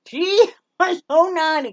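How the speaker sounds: laughing it out, high and loud; amused laugh over her naughty dog. Real, not acted. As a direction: break into a laugh mid-line. Laughing about the naughty poodle.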